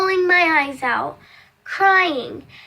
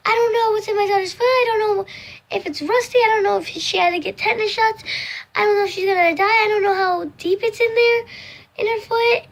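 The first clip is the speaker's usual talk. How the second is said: fast, higher, frantic worrying; panicky mom; 6 semitones higher, faster than usual. Retold or imitated. Mom at work panics over her daughter's foot, fast and high. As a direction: spill every fear at once. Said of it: "having an anxiety attack".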